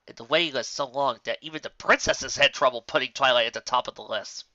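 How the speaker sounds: plain reading voice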